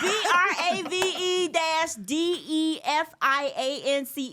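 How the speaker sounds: loud, high, defiant spelling; emphatic respelling. Real, not acted. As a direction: belt out each letter to prove it. Emphatically respells address after teasing.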